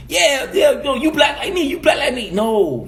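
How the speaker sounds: ordinary talk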